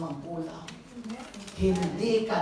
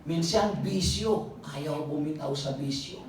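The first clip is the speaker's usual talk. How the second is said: ordinary talk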